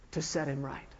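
ordinary delivery

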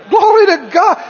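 loud, high cry of praise; shouted praise. Acted. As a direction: shout it out like a church cheer. Exclaiming praise for others.

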